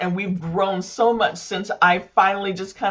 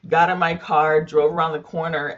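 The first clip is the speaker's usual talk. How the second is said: usual speaking voice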